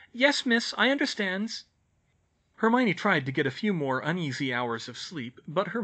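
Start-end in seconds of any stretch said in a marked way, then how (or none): 0.1-1.6 high-pitched little servant voice; obedient house-elf. A narrator's reading. Elf accepts Hermione's orders, high obedient squeak. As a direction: pipe up as a dutiful elf.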